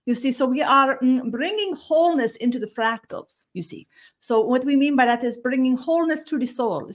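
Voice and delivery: a gentle otherworldly visitor greeting the humans; Taira channeled voice. Acted. Channeled being teaches.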